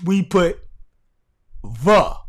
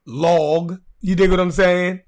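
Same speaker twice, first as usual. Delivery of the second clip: ordinary talk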